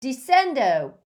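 loud, high cry of command; shouted spell. A narrator's reading. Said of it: "and shouted".

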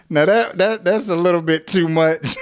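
amused. Real, not acted. Teacher recovering from laughter about her joke. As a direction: say it while still giggling.

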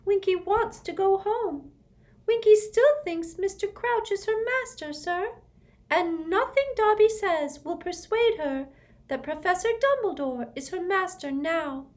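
a little elf pleading her case; squeaky house elf. Acted. Dobby explaining Winky to Harry, squeaky elf.

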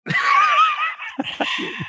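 a big shrieking laugh. Real, not acted. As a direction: howl with laughter at the headline. Reacting to the headline.

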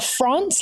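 "France" said by itself as a syllable; first syllable alone. Shown as a demonstration. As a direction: say "France" like the country, set apart. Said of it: "like the country France".

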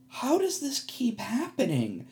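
exasperated disbelief. Retold or imitated. At the party, asking himself, high slow disbelief. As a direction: let the disbelief stretch out high. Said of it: "And I'm like".

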